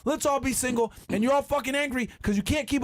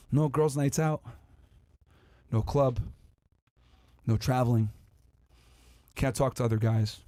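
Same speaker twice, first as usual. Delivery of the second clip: put-on low, deflated girl listing rules, long pauses; dismayed girl reading rules; 13 semitones lower than usual. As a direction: mutter each rule like bad news.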